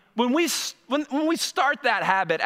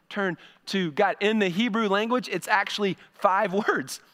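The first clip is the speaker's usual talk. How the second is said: ordinary talk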